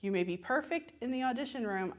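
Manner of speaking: ordinary delivery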